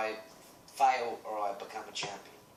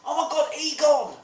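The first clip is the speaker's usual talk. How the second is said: put-on thrilled kid's squeal in a Cockney accent, much higher and louder; 12 dB louder than usual. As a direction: shriek it like an excited five-year-old, Cockney accent. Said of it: "With my little Cockney accent".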